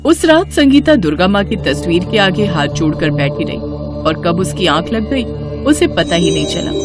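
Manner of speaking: usual speaking voice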